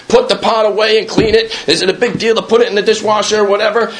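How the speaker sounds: high-pitched mock mother rant; mom ranting. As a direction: imitate an exasperated mom yelling about chores. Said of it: "And then you hear, like".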